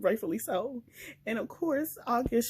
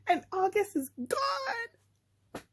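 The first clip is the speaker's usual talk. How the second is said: high, very slow wail between laughing and crying; a fan devastated that the hero is gone, laugh-crying; 10 semitones higher, much slower than usual. Real, not acted. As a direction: stretch it out in half-laughing despair. Reacting to a plot twist.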